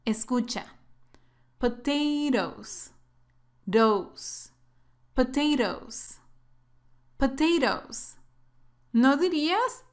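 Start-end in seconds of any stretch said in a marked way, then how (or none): 1.6-8.2 "Potatoes" with a soft tapped second t, like a quick d; American "Potatoes", last syllable like "Dos". Shown as a demonstration. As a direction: model "Potatoes" carefully with the soft flapped T. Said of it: "Esta T es muy suave. Escucha".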